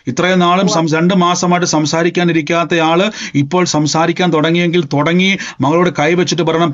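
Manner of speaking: ordinary talk, nothing marked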